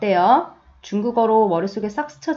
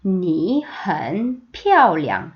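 very slow, syllable by syllable; slow teacher demo; slow model reading; 2 semitones lower, much slower than usual. Shown as a demonstration. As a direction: spell out 你很漂亮 slowly. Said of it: "했던 거 기억나시죠".

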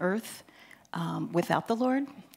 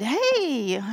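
excited greeting; 5 semitones higher, 6 dB louder than usual. Retold or imitated. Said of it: "he was so excited".

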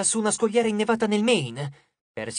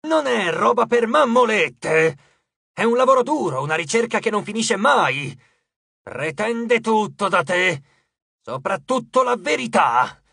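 raging god shouting; 3 semitones higher, 4 dB louder, slower than usual. A narrator's reading. Cupido shouting at Nico about love, furious.

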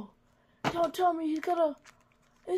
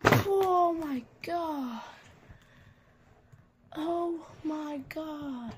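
stretched-out, very slow exclamation; mock slow-mo commentary; slow motion replay; 3 semitones lower, much slower than usual. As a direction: drag each word like a slowed replay. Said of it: "Let's get a slow motion replay of that".

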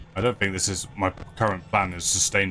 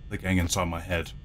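ordinary talk, nothing marked

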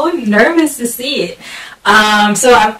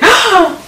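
high sudden gasp of surprise; real startled gasp; sharp gasp; 9 semitones higher than usual. Real, not acted. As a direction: catch your breath at the reveal. Clicks open the article.

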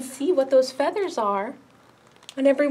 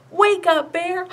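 loud high call; 7 semitones higher, 7 dB louder, slower than usual. Shown as a demonstration. Librarian calling out to wake the bear puppet, loud. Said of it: "you're gonna call out".

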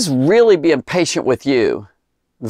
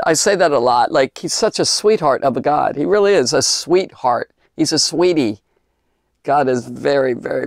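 normal talking voice

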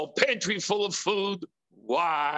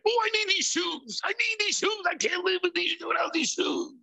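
high, whiny shoe craving; pleading like you can't live without them; a shopper begging for the shoes; 13 semitones higher, faster than usual. Retold or imitated. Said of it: "all of a sudden you say".

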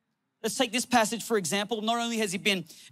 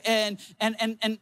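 ordinary talk

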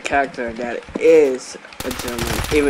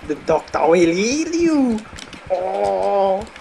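mock German medic; 3 semitones higher than usual. Taunting other players as the TF2 Medic in a mock German accent. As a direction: gleefully threaten them as the mad medic. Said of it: "Don't run from the medic".

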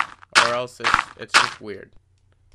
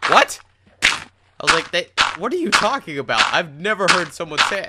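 shocked protest; 6 semitones higher, 4 dB louder than usual. Retold or imitated. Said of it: "And I was like".

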